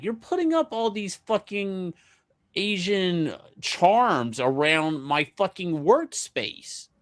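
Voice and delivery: mock outrage at the hidden charms; high, disbelieving complaint that slows down; incredulous accusation. Accusing wife over the charms.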